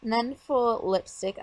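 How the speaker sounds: ordinary delivery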